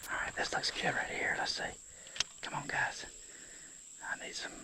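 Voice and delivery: hushed whisper while fishing. Real, not acted. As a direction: whisper to yourself, watching the water. Fishing quietly, whispering to self.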